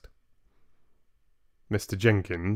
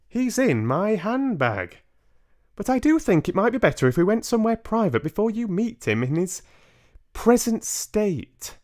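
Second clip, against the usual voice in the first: put-on higher, patient old lady; voiced grandmother; 13 semitones higher, 5 dB louder than usual. Grandmother insisting Bruno is in her handbag, patient old lady voice. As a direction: calmly insist like a wise granny.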